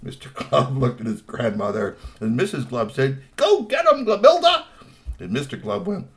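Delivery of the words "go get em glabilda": high, loud cheer; root for her at the top of your voice. A narrator's reading. Mother cheering her troll daughter on, high loud shout.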